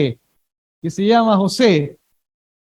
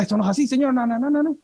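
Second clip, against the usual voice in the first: mock scolding; 4 semitones higher, 5 dB quieter, faster than usual. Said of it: "me grita".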